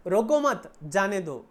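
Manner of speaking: "रोको मत" run together and loud, then जाने दो; phrase break after मत. Shown as a demonstration. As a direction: join मत to रोको, meaning don't stop. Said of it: "इसका मतलब do not stop". ("रोको मत" एक साथ ज़ोर से, फिर "जाने दो")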